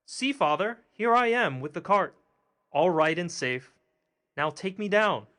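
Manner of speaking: high and loud, calling up to his father; little boy calling out to father, loud and high; cried out. A narrator's reading. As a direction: shout it proudly from the horse's ear. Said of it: "cried out".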